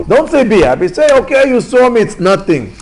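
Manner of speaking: high, loud, insistent objection; acted outcry of disbelief; heated protest. Onlooker objecting to the injured man's words, heated protest.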